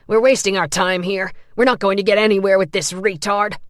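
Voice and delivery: disgusted orderly; contemptuous. A narrator's reading. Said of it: "the first man said".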